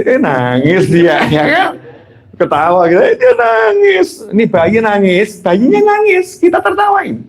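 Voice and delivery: high, stretched squeal of amusement; imitating delighted adults cooing over a bawling baby; amused at crying baby. Adults laughing at a newborn's cry, mock delighted.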